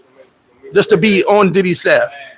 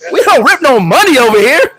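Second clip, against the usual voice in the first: going off on her; 6 semitones higher, 4 dB louder than usual. Retold or imitated. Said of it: "Here's why I'm about to go off on her".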